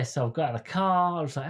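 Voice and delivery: normal talking voice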